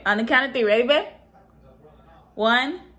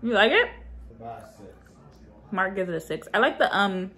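speaking with food in mouth; chewing while talking; 3 semitones lower, faster than usual. Real, not acted. Seen 0: Bites treat just before; closed, working mouth while talking early on.

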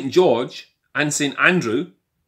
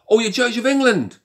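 high, pointed fake astonishment; mock surprise; 9 semitones higher than usual. Answering the churchgoer with mock surprise. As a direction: feign shock at what he just said.

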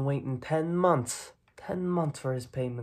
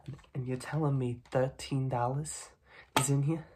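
ordinary talk, nothing marked

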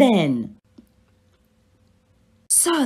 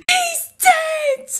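crying. Acted. Harry crying over Cedric.

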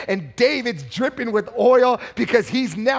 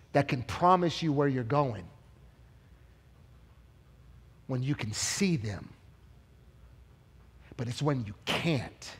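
hushed preaching; 6 semitones lower, 8 dB quieter than usual. Acted. Preacher softly pleading with the congregation, hushed. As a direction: drop to a soft, close murmur.

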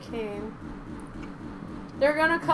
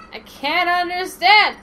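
loud; 6 semitones higher, 8 dB louder than usual. Acted. Repeated louder.

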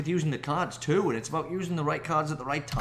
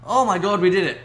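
loud, high cry of relief; joyful cry; 6 semitones higher, 11 dB louder than usual. Real, not acted. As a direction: celebrate the win. Winning the battle.

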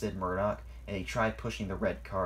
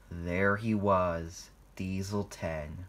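ordinary delivery

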